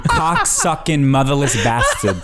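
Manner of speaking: put-on mother cursing out a driver in thick Brooklyn accent, dropped R's; Brooklyn mom impression. Imitating her mom cursing another driver, Brooklyn accent. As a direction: snarl it like a Brooklyn mom in traffic. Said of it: "a perfect impression of my mother".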